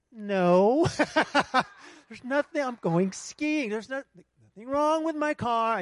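high, insistent brush-off; stubborn denial. Retold or imitated. Preacher answering the Spirit, stubbornly insisting. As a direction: refuse to listen, dead set on skiing. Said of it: "And I'm like".

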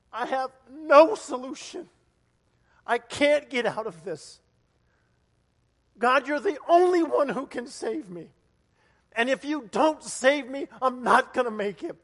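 loud, high plea to God; desperate crying out. Acted. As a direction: cry out as if at rock bottom. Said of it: "where you cry out and you really mean it".

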